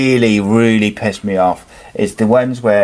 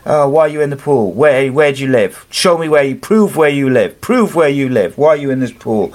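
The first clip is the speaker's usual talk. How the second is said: mocking an aggressive old woman; 6 semitones higher than usual. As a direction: play the nasty neighbour who challenges him, quick and shrill-voiced. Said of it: "and she's like".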